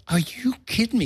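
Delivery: can't believe what he hears; incredulous. Retold or imitated. Retelling a friend's claim, incredulous high question.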